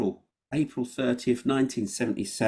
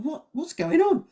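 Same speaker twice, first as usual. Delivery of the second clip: startled. Retold or imitated. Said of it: "She's like".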